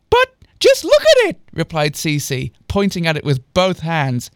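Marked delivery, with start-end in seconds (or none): 0.1-1.4 very high, loud squeaky protest; C C's voice. Acted. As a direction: protest in an indignant falsetto. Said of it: "I forget what C C's voice is".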